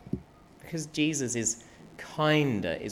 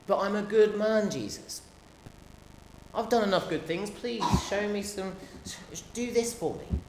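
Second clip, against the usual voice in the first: proud man's plea; 8 semitones higher than usual. Acted. Preacher voices proud man to Jesus.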